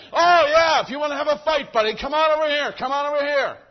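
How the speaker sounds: very loud, high imitated fighting shout; hammed-up irate yell across a parking lot; yelled challenge. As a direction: bellow it like you're picking a fight. Imagined woman challenging him to fight.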